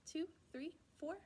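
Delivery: plain reading voice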